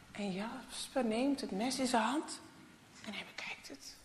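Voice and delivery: usual speaking voice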